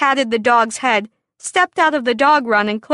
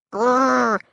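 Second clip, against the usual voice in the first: dog growl. Acted. Dog attacks stuck rooster.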